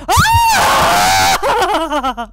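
very loud, high scream; startled scream. Real, not acted. Player screams while hunting the ghost, startled scream. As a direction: shriek at the top of your voice.

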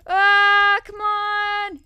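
loud, high cry of frustration; real anguish at just missing; frustrated yell. Real, not acted. Player yelling at his near miss, loud and frustrated.

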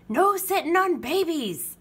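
high, protesting pirate holler; crew holler. A narrator's reading. Pirate crew refusing to babysit, hollered protest. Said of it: "hollered the crew".

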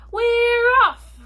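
loud high cheering shout; crew shout. Acted. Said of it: "we all shouted".

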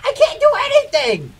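high-pitched helpless protest; frustrated outburst. Real, not acted. Gamer helpless in the fight, frustrated high cry. Helpless against opponent in game.

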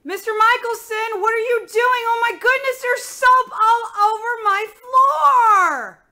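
very high, very loud outraged shriek; piercing, drawn-out scolding yell. Acted. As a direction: screech in horror at the mess. Scolded over soap on floor.

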